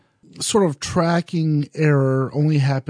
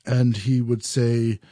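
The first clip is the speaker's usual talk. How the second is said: ordinary delivery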